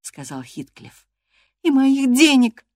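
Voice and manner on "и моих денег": high, loud, slow addition; bold defiance. A narrator's reading. Girl meets the master's angry stare, boldly defiant. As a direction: push back boldly, pitched high. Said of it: "продолжала она, смело встретив его гневный взгляд".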